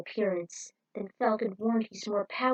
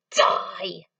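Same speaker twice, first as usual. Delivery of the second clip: whispered but forceful, louder hiss; menacing hissed die; 6 dB louder than usual. Acted. Villain condemns the traitor, a hissed whisper. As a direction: spit the word as a threat.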